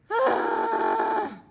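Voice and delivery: hammed-up loud groaning sigh; a loud dramatic sigh of disappointment; big sad sigh. Pete sees the rain.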